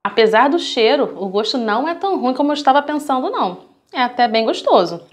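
speaking between bites; talking while chewing. Real, not acted. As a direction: mouth partly full of food. Seen 0: Ate noodles at -7; cheeks stay puffed, chewing motions while speaking.

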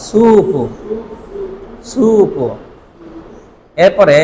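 ordinary talk

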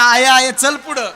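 high, loud answering shout; calling in reply. Acted. Short husband telling his wife to walk on, loud call. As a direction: answer loudly from behind.

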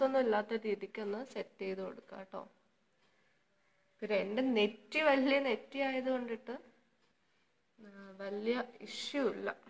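stiff lips, hands pressing cheeks; speaking while smoothing face mask; through a sheet mask. Real, not acted. Seen 2: Sheet mask worn throughout; hands pressing it near mouth.

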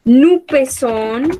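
slow, clear "Nous pesons"; slow model conjugation. Shown as a demonstration. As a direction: dictate "Nous pesons" slowly. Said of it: "teacher models conjugation". (dictado lento)

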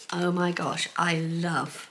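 usual speaking voice

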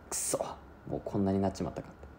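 soft mumble under his breath; muttering to himself. Retold or imitated. Sick father mutters alone while spitting blood. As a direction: mutter it so no one hears. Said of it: "ボソボソ言ってて".

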